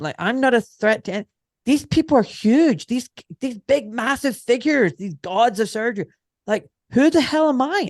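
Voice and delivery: I'm nobody, how could I threaten them; incredulous protest. Retold or imitated.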